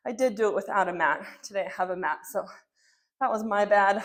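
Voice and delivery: ordinary talk, nothing marked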